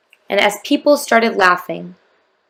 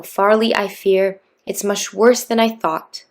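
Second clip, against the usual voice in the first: plain reading voice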